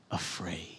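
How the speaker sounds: softly whispered "afraid". Acted. Jesus soothing scared disciples, whispered. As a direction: breathe the word like reassurance. Jesus' words voiced gently.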